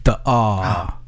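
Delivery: The Rock impression. Shown as a demonstration. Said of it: "eighteenth letter of the alphabet".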